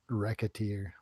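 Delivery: Racketeer with a hard K in the middle; corrected pronunciation. Shown as a demonstration. Co-host correcting a game title, Racketeer said with a K sound. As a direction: say Racketeer as "reck-uh-teer". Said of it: "I think it's pronounced".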